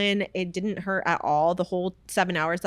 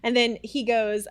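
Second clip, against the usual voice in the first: usual speaking voice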